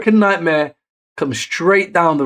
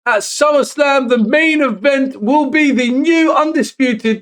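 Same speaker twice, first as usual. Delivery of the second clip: higher, punchy ring-announcer build; hype announcement; 9 semitones higher, 3 dB louder than usual. Real, not acted. Telling viewers the title match is official, hyped announcer voice. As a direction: announce the main event like it's huge.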